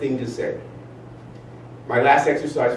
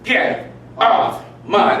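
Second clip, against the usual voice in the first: slow, drawn out and louder; forceful; 7 semitones higher, 3 dB louder, much slower than usual. Shown as a demonstration. Leading the group exercise, slow and forceful. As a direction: stretch it out with force. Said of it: "like, really get into it".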